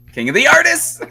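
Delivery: loud, high rising cry; mock triumphant proclamation. Host crowning himself to co-host, hammed-up proclamation. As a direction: proclaim it like a grand title. Hammed-up cheer of own title.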